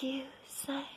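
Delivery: unvoiced tag dropping right down; whisper. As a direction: undercut the shout with a whisper. Whispered tag after shout.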